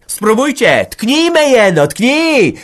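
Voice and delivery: very high, loud taunting shriek; defiant screaming. Acted. Village head's wife screaming a dare at the old woman. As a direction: dare her to strike. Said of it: "wrzeszczała wyzywająco".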